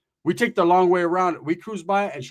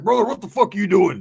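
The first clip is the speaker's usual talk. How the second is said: loud, higher-pitched brash greeting; usual phone manner; 4 semitones higher, 5 dB louder than usual. Retold or imitated. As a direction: imitate a buddy demanding answers on the phone. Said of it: "that's how the way he would talk on the phone".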